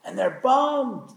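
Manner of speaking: slow, high, mopey letdown; bummed. A narrator's reading. Preacher telling how the early workers feel bummed. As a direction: drag out the disappointment.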